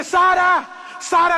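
high, loud cry of a name; shouting. Acted. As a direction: yell for your lost child. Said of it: "empecé a gritar". (gritando angustiado)

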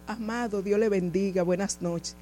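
soft, low, modest greeting; retold polite greeting. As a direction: greet the driver humbly, voice well down. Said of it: "Y le digo".

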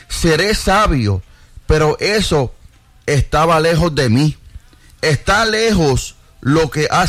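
measured reading with pauses between phrases; slow scripture reading. Real, not acted. Reads Solomon's words to the congregation, slow reading. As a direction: read the verses slowly and deliberately. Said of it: "los voy a leer despacio".

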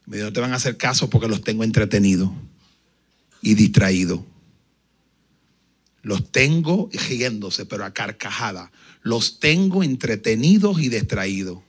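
the demon mocking the praying preacher. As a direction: sneer it like a gloating demon. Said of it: "burlándose".

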